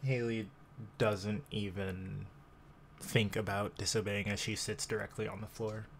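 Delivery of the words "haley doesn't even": normal talking voice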